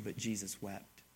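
hushed tender. Real, not acted. Tender emphasis on Jesus wept.

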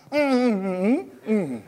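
wordless "mm" of someone unable to speak; muffled humming in place of words; mute man's humming. Acted. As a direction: hum through closed lips, imitating a mute. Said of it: "he was mute for months".

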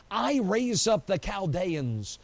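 slow, weighty I raise up the Chaldeans, leaning on I; deliberate scripture emphasis; slow stress on I. Shown as a demonstration. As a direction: land each word slowly, I first. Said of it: "notice the words carefully".

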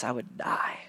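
soft whisper of desperate need; hushed, dying-away plea; whispered prayer. Acted. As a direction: breathe it out like a confession.

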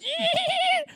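very high, sustained squeal imitating brakes; screeching brakes. Preacher imitates the driver slamming the brakes, shrill screech. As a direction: squeal like tires stopping hard.

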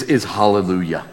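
usual speaking voice

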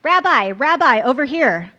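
loud, high shout across the water; calling out. Acted. Andrew shouts to the rabbi from the boat, loud call. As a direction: yell to be seen over a crowd. Andrew waves wildly at crowd.

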